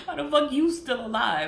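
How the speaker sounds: incredulous laugh on the repeat; laughing disbelief. Retold or imitated. Mocking her enemy's survival, laughing. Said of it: "I'm like".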